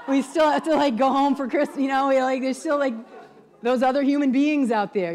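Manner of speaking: amused rant, laughing through the words. Real, not acted. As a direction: joke about the holidays, laughing. Laughing about family holidays.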